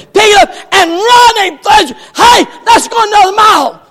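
high, loud, fast eager shout; enthusiastic. Acted. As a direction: burst out eager to go on.

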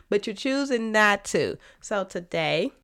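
ordinary delivery

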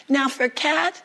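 usual speaking voice

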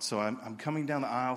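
ordinary talk, nothing marked

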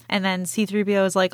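usual speaking voice

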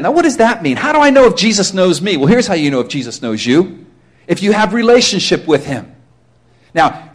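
ordinary talk, nothing marked

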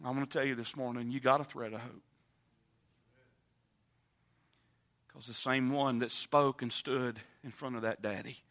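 hushed preaching. Acted. Preacher speaking to the congregation in a low, hushed, intimate voice. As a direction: drop to a near hush and draw them in.

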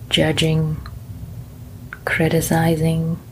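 gentle meditation guide; guided meditation. Acted. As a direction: ease them into calm, one body part at a time.

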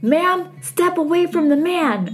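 high-pitched mock police order; retold SWAT command, not shouted; SWAT team command. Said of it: "whole swat team that's pointing rifles".

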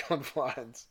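plain reading voice

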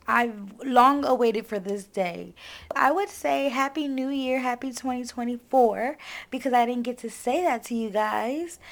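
ordinary delivery

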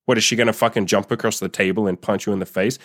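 normal talking voice

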